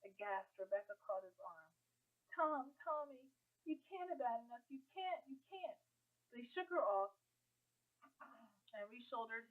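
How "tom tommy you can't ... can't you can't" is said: high, desperate pleading. A narrator's reading. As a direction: beg him not to go. Pleading brother not to leave.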